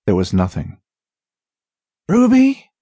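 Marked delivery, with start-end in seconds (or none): none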